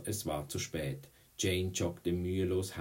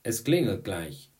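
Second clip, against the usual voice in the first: raised, louder warning call; urging call; 3 semitones higher, 7 dB louder than usual. A narrator's reading. Boy on first base warns the pitcher, urging call. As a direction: call out that time is short.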